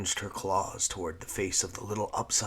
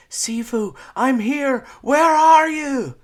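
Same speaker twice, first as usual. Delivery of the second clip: loud, high desperate call; 17 dB louder, slower than usual. Acted. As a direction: shout into the trees searching. Calling through the forest.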